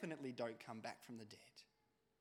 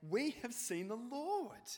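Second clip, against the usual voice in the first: joyful announcement; 6 semitones higher, 4 dB louder, slower than usual. Acted. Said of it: "the disciples ... say".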